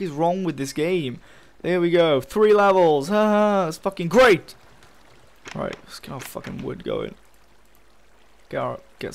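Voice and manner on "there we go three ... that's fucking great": louder, higher sarcastic cheer; exasperated fake delight; bitter mock celebration. Real, not acted. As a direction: cheer it through your frustration. Bitter about regaining only three levels.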